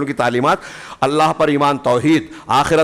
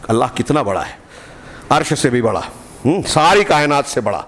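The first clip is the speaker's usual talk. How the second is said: usual speaking voice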